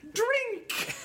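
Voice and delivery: very high, drawn-out shriek; playful cry. Pretend. Answering partner's Batman mention, playful high cry. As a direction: squeal it out like a party cue. Exclaims the game word.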